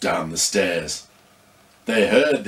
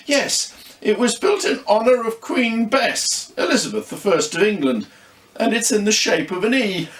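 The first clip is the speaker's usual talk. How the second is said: a hint of a young girl; lightly raised child voice; lightly voiced child; 9 semitones higher than usual. Yo-Yo answers in story.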